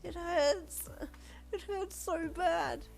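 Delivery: high, whimpering hurt voice; tearful pained whine, slow at the end. Acted. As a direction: whimper through the pain. Injured reader in agony.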